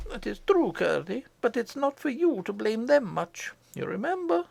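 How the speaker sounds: mild rebuke, pitched high; the mother, gently chiding. Acted. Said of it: "said his mother".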